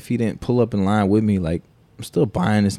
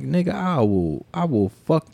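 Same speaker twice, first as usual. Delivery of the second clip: higher-pitched, halting angry warning; retold threat; 7 semitones higher, slower than usual. Brother warns off the man bothering little brother, retold threat. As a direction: re-enact warning someone off, pitch raised.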